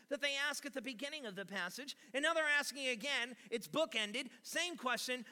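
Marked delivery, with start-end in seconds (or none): none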